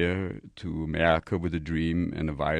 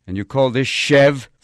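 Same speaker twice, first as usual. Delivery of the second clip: 1 mock loud outburst; 8 semitones higher, 14 dB louder, slower than usual. Comic outburst.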